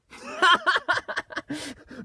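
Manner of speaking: sudden high-pitched laugh; high burst of laughter. Real, not acted. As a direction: let out a squealing laugh. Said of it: "But I'll be laughing".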